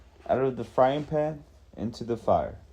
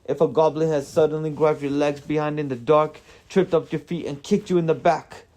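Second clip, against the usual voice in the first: higher, louder dwarf in indignant protest; heated, pitched-up character retort; defensive dwarf; 6 semitones higher, 5 dB louder than usual. A narrator's reading. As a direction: argue your case as the accused dwarf.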